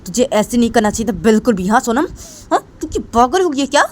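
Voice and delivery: high, loud furious scolding; angry scolding. Acted. Piyush angrily scolding Sonam over the phone. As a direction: tear into her, rising in fury. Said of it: "Piyush वो सोनम के ऊपर गुस्सा कर रहा था".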